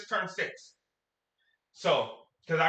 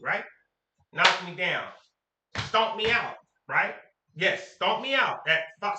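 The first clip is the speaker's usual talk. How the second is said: usual speaking voice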